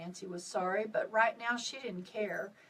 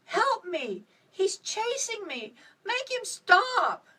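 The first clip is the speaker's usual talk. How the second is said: high, loud panicked cries for help, slowing at the end; a lost child screaming for rescue; terrified screaming; 10 semitones higher, 7 dB louder, slower than usual. A narrator's reading.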